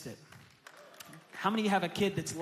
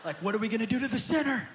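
fast, louder, keen voice; eager onlooker; 4 semitones higher, 8 dB louder, faster than usual. Retold or imitated. Brother watching for punishment, fast eager. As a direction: rush it like a kid hungry for punishment.

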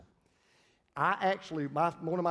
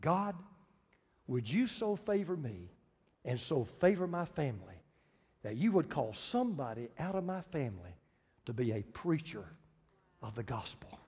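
grandmother's prayer; 4 semitones lower, 4 dB quieter than usual. Retold or imitated. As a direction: pray it in measured phrases, sinking softly at the end. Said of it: "this is what your grandmother prayed".

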